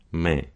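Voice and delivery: "man" ending on a nasalized vowel; dropped final N. Shown as a demonstration. Coach repeating the accent example, nasal "man" without its N. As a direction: drop the N of "man", leaving the vowel in the nose. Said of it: "the N drops off and the vowel becomes nasalized".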